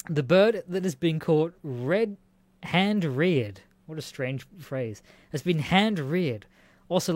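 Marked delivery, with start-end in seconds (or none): none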